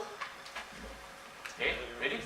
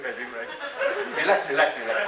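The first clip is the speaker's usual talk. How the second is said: laughing reassurance. Real, not acted. Calming pressured volunteers, laughing.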